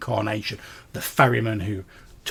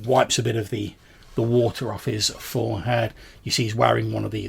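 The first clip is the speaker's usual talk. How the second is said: ordinary talk, nothing marked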